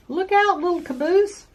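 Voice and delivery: high, loud warning call. Acted. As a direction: shout the warning up the train. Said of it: "called the flat car".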